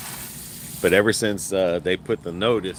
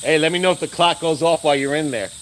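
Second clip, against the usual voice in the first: raised, high call through the house; calling out to another room; 9 semitones higher, 4 dB louder, faster than usual. Real, not acted. Asking the person indoors about the clock, called out. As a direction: call out fast so he hears you from the other room.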